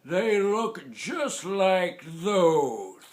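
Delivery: suspicious growl. Acted. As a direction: drag it out suspiciously as grandpa. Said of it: "growled suspiciously".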